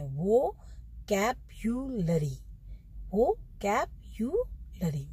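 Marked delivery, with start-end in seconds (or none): 1.1-5.1 वो कैप्युलरी slowly, split into separate beats; teacher's slow drill of वो कैप्युलरी; correct syllable-by-syllable model. Shown as a demonstration. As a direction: stretch वो, कैप, यु, लरी one by one. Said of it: "इसको बहुत लोग गलत बोलते हैं".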